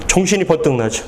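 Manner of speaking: ordinary talk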